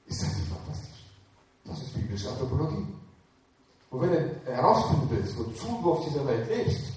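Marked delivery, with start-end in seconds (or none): none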